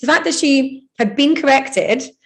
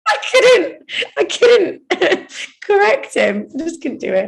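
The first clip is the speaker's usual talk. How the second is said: laughing; 8 semitones higher, slower than usual. Real, not acted. Laughing about ballet teacher.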